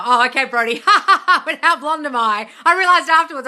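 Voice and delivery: loud, high laughter at herself; laughing. Real, not acted. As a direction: crack up at your own blunder. Laughing at her own mistake.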